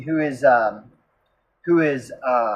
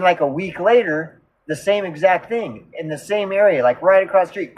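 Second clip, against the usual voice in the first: normal talking voice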